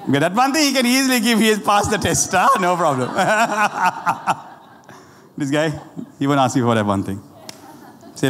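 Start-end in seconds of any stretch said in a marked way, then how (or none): 3.1-4.3 long chuckling laugh; real laugh. Real, not acted. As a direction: laugh it out in a run of ha-has. Preacher laughs at his own remark.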